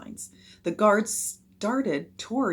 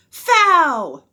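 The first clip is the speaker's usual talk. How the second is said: loud, high, stretched call; ringing ruling from the field; umpire's shout; 10 semitones higher, 13 dB louder than usual. A narrator's reading. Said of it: "the umpire shouted".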